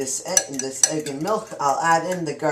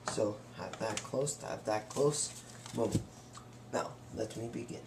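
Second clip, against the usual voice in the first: quiet; 4 semitones lower, 12 dB quieter than usual. Real, not acted. Repeated soft instruction.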